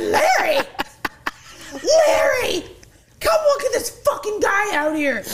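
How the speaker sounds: high, loud call across the club. Retold or imitated. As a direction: call Larry over, urgent and giddy. Calling the manager over urgently.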